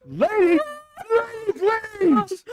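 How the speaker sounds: high, pleading mock shout; retold plea. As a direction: imitate begging her to stop. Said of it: "she's yelling her head off".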